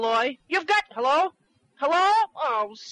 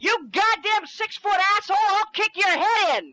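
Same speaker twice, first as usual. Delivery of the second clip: furious shout; 7 semitones higher than usual. Acted. Little man screaming threats at his tall partner, furious. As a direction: bellow threats with full fury.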